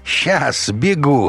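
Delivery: high, slow, drawn-out reply; mocking call-back. A narrator's reading. Rapper answering the colonel's plea, high mocking call. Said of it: "хмыкнул чернокожий рэпер".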